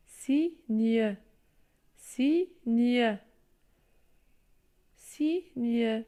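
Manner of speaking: signe stretched out, sign-e with the final e sounded; teacher drawing out signe; syllable reading. Shown as a demonstration. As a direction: say signe slowly so pupils can split it. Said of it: "Je lis".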